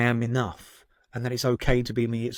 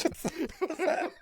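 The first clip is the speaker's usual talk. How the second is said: helpless high squeal of laughter; high-pitched laugh; 7 dB quieter than usual. Real, not acted. Laughing at his own accent story, high helpless laughter. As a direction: crack up in a high, quieter wheeze.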